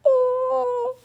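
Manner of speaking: high little gasp of delight; a squeaky, moved "oh"; touched. Real, not acted. Touched by a heart on the ring, squeaky oh.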